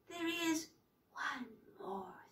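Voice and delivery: soft, breathy sinister whisper; witch's dry whisper. Acted. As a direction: whisper it like a secret trap. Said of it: "The voice was a dry whisper".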